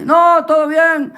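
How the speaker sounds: high, stretched-out denial; acted innocent parent; feigned innocence. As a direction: cover up in a high, slow voice. Parents cover up to inspector.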